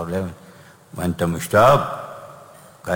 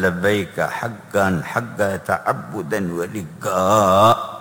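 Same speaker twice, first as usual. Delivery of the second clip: very slow, drawn-out reverent chant of لبيك حقا حقا; very slow intoned call; much slower than usual. Real, not acted. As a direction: draw out every syllable devoutly. Repeating the talbiya.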